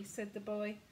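ordinary delivery, nothing marked

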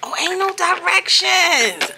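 high, slow, drawn-out disbelief; exasperated disbelief. Real, not acted. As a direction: stretch it out in disbelief. Finds no instructions.

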